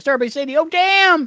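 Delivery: high, loud mock outburst; imitated shout. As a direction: shout it out like an excited impression. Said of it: "Rutherford shouted out stuff".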